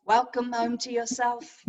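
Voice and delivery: slow, level-pitched meditation welcome; guided meditation. Acted. Teacher welcoming resters home to themselves, in slow meditation voice. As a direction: settle them in, slowly and evenly.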